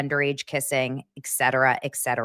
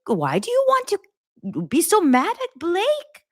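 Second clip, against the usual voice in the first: imitating a fretful, high-pitched mom; scared mom; 12 semitones higher than usual. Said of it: "And she goes, "I'm scared".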